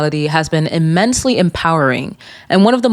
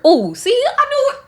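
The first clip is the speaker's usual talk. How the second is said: high gotcha. Real, not acted. Reacting to the however.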